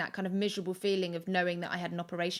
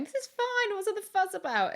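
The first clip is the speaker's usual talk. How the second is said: much higher, breezy smug voice; mock-smug self in a vintage dress; smug early-labour me; 12 semitones higher than usual. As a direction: play it airy and overconfident.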